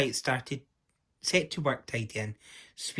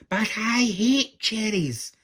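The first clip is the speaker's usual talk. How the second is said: high, loud, drawn-out protest; angry shout; 10 semitones higher, 6 dB louder, slower than usual. A narrator's reading. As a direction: shout it slowly in an indignant tantrum. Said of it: "shouted Angry".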